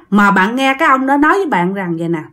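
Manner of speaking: usual speaking voice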